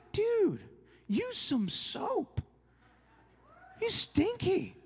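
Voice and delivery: high-pitched, drawn-out teasing complaint; mock disgust. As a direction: imitate someone grossed out, playfully.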